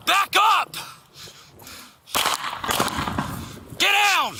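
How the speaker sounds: yelling at a suspect; shouted commands. Real, not acted. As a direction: bark orders at full force. Officer ordering suspect at gunpoint.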